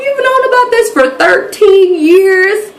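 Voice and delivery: very high, drawn-out disbelief; retold astonishment, pitch soaring; incredulous outburst. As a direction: stretch the question in indignant shock. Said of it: "I'm like".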